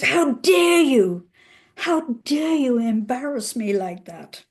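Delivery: imitating a husband blowing up at his wife; angry outburst. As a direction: hammer out each word like a furious husband. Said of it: "he blew up".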